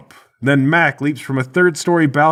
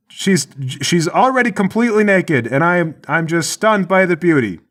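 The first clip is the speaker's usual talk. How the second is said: higher-pitched, halting excuse; lying guard; 6 semitones higher than usual. Acted. Guard covering for a trap, halting called-out lie. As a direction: stumble through a made-up excuse, pitched up. Guard lies loudly to partner.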